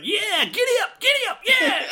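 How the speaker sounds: high mock cowboy shout; mock high yell. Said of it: "he's like".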